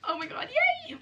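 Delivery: tiny high-pitched yay; very high, excited little cheer; real excitement. Real, not acted. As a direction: squeal with giddy delight. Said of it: "I'm so excited by this".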